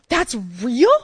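high, loud astonished question; can't believe it; amazed student asking if it's real; amazed disbelief. Retold or imitated. Amazed girl asks.

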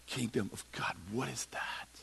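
hushed puzzled question; whisper. Acted. Disciples whispering their confusion to Jesus. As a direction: whisper it, baffled.

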